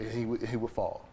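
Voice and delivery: usual speaking voice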